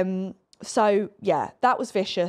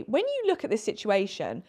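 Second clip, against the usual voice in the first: usual speaking voice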